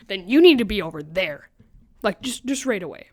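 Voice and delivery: loud, sharp mock order; teacher ordering the narrator off loudly; bossy teacher. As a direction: bark it like a strict teacher. Teacher's order voiced in story.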